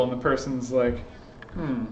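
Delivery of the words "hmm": skeptical hmm. Shown as a demonstration. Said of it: "they just raise an eyebrow".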